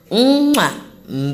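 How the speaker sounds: big smacking kiss sound; blown kiss. Real, not acted. Podcaster saying good night to listeners, blown kiss.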